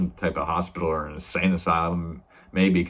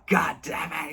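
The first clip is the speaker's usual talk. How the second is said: mock angry curse; 9 semitones higher than usual. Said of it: "Henriksen's fucking ripping".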